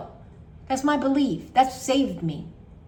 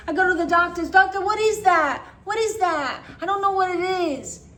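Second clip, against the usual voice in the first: higher, louder anxious pleading; frantic doctor visit; 8 semitones higher, 5 dB louder than usual. Retold or imitated. Retelling anxious self at the doctor, higher and frantic. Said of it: "Continue is".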